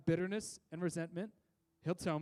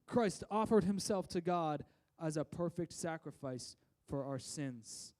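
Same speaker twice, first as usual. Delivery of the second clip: slow, weighty Bible reading from the pulpit; solemn scripture reading; 3 semitones higher, 3 dB louder, slower than usual. Acted. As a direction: lay each word down slowly like sacred text. Reads scripture aloud.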